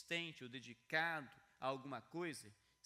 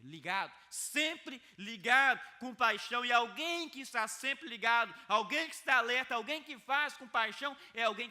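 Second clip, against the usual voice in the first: full-force preaching; 5 semitones higher, 11 dB louder than usual. Acted. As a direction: preach it out loud to stir the room.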